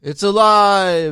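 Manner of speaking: slow, drawn-out triumphant cry; mad scientist shout. Acted. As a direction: bellow it like a mad doctor. Quoting the Frankenstein film.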